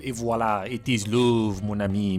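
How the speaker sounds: slow, hammed-up French romantic line, lightly done; mock French accent. Narrator teasing the couple, mock French accent. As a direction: purr it like a cheesy French lover.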